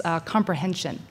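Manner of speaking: usual speaking voice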